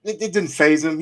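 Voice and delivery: usual speaking voice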